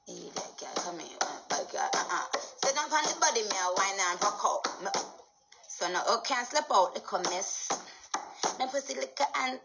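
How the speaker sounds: rhythmic Jamaican patois riff, half-chanted; playful Jamaican patois lyric. Pretend. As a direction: rattle off lines in Jamaican patois. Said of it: "You do not understand Jamaican".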